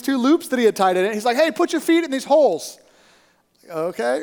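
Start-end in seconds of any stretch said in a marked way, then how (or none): 1.4-2.8 high imitated call from above; called instruction. Stranger instructing climber on the rope, called out. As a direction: call it down to someone below.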